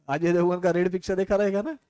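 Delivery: usual speaking voice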